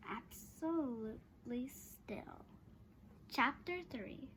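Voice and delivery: ordinary delivery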